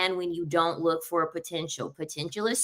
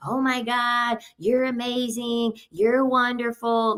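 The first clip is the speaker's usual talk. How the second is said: higher, sugary fake praise, slowing on the compliments; mock gushing admiration; 5 semitones higher, slower than usual. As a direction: ham up being impressed by his house. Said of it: "that's the time for you to just be like".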